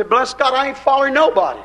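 high, fast mocking imitation of a churchgoer. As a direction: scoff it like a self-righteous believer. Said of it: "we say".

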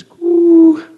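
long, loud, high coo; high hooted bird call; answering dove coo. Acted. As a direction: imitate a turtledove calling back. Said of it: "that other turtle dove answers back".

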